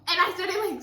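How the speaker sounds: usual speaking voice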